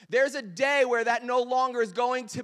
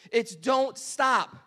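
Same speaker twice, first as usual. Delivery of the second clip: slow, stretched "don't stop"; drawn out; 2 semitones higher, 3 dB louder, much slower than usual. Shown as a demonstration. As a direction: drag out "It's don't stop" like a song hook. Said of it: "Don't say it, Tim".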